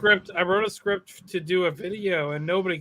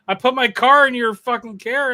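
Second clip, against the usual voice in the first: outraged; 4 semitones higher, 8 dB louder than usual. Real, not acted. Outraged rant about signing.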